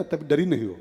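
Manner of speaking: ordinary talk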